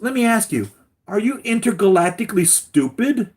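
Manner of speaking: very high, loud incredulous sneer; mocking villain. Villain mocking Lois's blindness, very high loud sneer. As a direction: squeak out the insult in disbelief. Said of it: "He goes".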